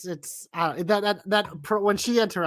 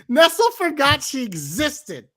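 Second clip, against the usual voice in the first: usual speaking voice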